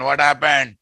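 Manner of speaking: ordinary talk, nothing marked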